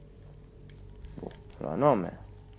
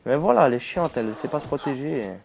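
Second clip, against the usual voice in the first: exasperated outburst; 5 semitones higher, 7 dB louder than usual. Real, not acted. Annoyed at companion in fight.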